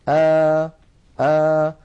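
open, medium alef aa held loud; middle open alef. Shown as a demonstration. Teacher to students, showing the middle alef sound. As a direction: say آ with the mouth half open. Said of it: "هكذا، اسمعوني جيدا".

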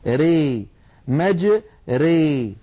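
ري split out with a full e vowel and light ra; syllables with imala. Shown as a demonstration. Teacher breaking the word into syllables for students. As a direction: spell out مج and ري, bending ري to re. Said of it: "بالإمالة الكبرى".

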